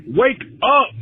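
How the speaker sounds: mild Macho Man-style shout; Macho Man impression. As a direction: channel a hyped wrestler telling you to wake up. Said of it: "Like Macho Man said".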